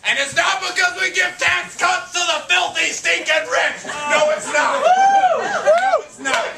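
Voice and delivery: high-pitched comic rant, peaking in a yell; mock outrage. Host denying America's tax cuts to the crowd, mock outraged rant. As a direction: build the tirade to a loud, high NO.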